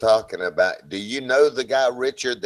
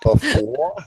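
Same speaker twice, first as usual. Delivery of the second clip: mock Kiwi accent; 4 dB louder than usual. Shown as a demonstration. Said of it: "you weren't too bad before".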